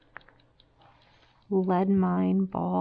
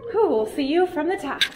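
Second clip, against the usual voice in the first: out of breath; 7 semitones higher, 5 dB louder than usual. Real, not acted. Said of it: "Whew, I'm still out of breath".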